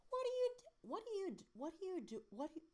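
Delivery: very high put-on British woman; false starts in a squeaky-high British accent; trying out a mother's high British voice; British accent attempts. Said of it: "I have to get the voice right".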